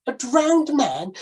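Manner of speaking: the gentleman aghast at the sailor's offer; shocked gentleman. Acted. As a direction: repeat it in disbelief.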